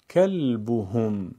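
کلبهم in one slow flow, holding the l; joined-up reading. Shown as a demonstration. Teacher puts the pieces together: کلبهم slowly as one word. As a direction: run کلبهم together without breaks. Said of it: "پس از اون به صورت پیوسته قرائت کنید".

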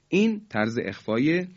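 ordinary talk

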